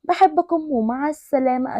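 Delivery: plain reading voice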